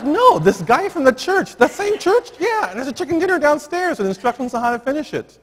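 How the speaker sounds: high, loud, tumbling retelling; wife telling her husband about the church man, excited. Retold or imitated. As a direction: blurt out the good news.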